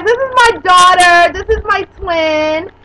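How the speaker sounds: ordinary talk, nothing marked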